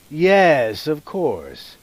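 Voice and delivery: ordinary talk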